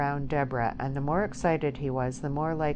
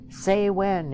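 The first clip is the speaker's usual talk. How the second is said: insistent demand; 5 semitones higher, 6 dB louder than usual. A narrator's reading. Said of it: "he repeated".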